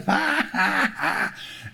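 high, real burst of laughter; laughing hard. Real, not acted. Preacher laughing at his friend's story, helpless laughter. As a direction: laugh your head off. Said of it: "I'm laughing my head off".

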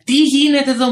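put-on high, loud indignant cry of an elderly neighbour; mock angry neighbour. Neighbour scolding him in the morning, mock indignant.

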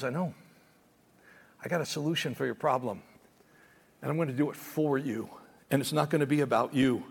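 low, soft, gentle voice of God; God reassuring a struggling believer, low and soft; God answering. Retold or imitated. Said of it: "And God goes".

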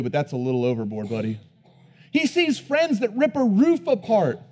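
fiery preacher; full force preaching. Acted. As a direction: preach it hard, building to a peak.

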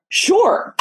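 a sarcastic squeaky agreement; sarcastic sure. Retold or imitated. Telling a podcast host about her grandmother's finishing school plan, sarcastic high-pitched agreement. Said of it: "And so I was like".

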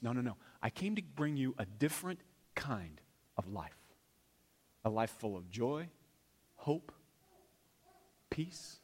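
whispered, soft and intimate; a pastor whispering the words of Jesus; hushed Jesus. Acted. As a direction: breathe it like a secret promise. Jesus voiced, hushed, in sermon.

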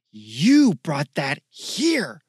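angry accusation. Acted. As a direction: hurl the blame at him. Zoe accuses Michael.

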